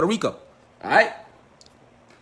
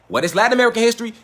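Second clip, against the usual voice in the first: ordinary talk, nothing marked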